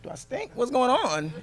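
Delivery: high-pitched, mock-bewildered retelling; retold puzzled question. Son retells his dad's first day at school, bewildered and high-pitched. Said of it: "So he was like".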